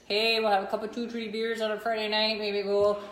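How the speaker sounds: hammed-up Wisconsin-Canadian accent. Transplant imitating Friday night back home, broad Wisconsin accent. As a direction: put on a broad Upper Midwest accent. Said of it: "What accent is that?".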